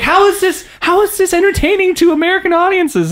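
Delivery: high, incredulous exclamation; mock bafflement. Reacting to Downton Abbey previews, in high-pitched bafflement. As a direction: pitch it way up in disbelief.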